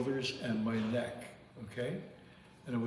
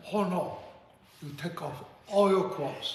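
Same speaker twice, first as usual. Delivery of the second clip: hammed-up Chinese accent, pitched high; mock Chinese-accented order; 6 semitones higher, 4 dB louder than usual. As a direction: imitate a Chinese accent, higher and punched up. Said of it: "you can't do that impression".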